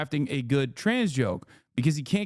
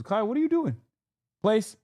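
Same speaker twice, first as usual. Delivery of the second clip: calling out; 8 semitones higher, faster than usual. Real, not acted. Scolding a dog mid-rant.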